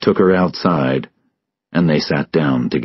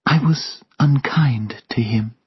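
high, whispery regret; hushed, high-voiced sorrow; sad; 8 semitones higher, 3 dB quieter than usual. A narrator's reading. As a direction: confess it sadly, almost in a whisper. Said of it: "Sylvia said sadly".

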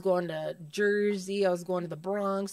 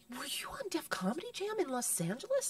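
hushed, softer secret question; whispered question; 6 semitones higher, 8 dB quieter than usual. Retold or imitated. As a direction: ask it in a near whisper. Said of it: "And she goes".

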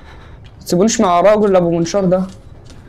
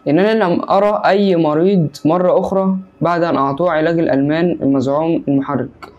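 ordinary talk, nothing marked

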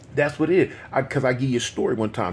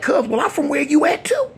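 claiming the set; 4 dB louder than usual. Retold or imitated. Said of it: "I tell him, I'm like".

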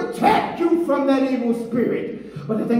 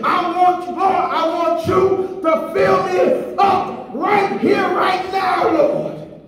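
full-force preaching; 3 semitones higher than usual. Real, not acted. Preacher crying out to God for filling, full force. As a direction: preach it out, building to a shout.

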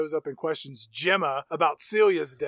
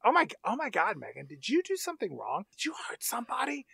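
acted anxious questioning, dropping into a whisper; hushed alarm; 4 semitones higher, 6 dB quieter than usual. Maker asking robot if it hurt someone, hushed mock dread. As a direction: imitate a dread-filled, much softer question.

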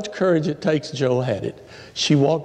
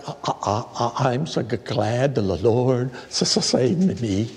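slow, lower halting stammer; stuttering testimony; 4 semitones lower, slower than usual. Retold or imitated. Woman giving her testimony, slow and stuttering. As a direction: stumble gently through the words. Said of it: "she stuttered".

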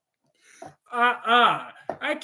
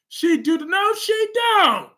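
incredulous; 9 semitones higher, 3 dB louder, slower than usual. Real, not acted. Mock exaggerated disbelief.

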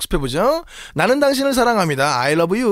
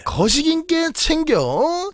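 Jeolla dialect; 7 semitones higher, much slower than usual. Shown as a demonstration. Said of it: "다른 말로 하면".

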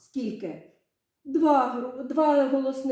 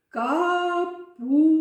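"Капуста" in three slow, raised pieces, "ка-пус-та"; syllable by syllable; 6 semitones higher, 4 dB louder, much slower than usual. Shown as a demonstration. Teacher counts syllables with pupils' palms under chins, syllable by syllable. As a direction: split "Капуста" into long separate syllables. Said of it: "Поставили долоньки".

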